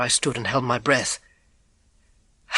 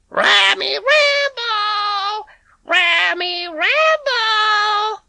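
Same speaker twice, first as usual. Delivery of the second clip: put-on high, slow sing-song call of an old lady; imitating Mrs Bloom; 5 dB louder, much slower than usual. Boy practising the cat call, high and drawn out. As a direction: stretch out the call like she does.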